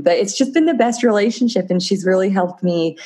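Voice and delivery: ordinary talk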